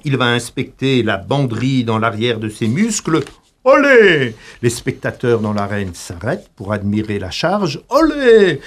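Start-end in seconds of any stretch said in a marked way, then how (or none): 3.6-4.3 high, loud joyous olé; hammed-up corrida shout; mock bullring cheer. Bullfight crowd cheer, mocked. 7.9-8.6 high, loud joyous olé; hammed-up corrida shout; mock bullring cheer. Bullfight crowd cheer, mocked.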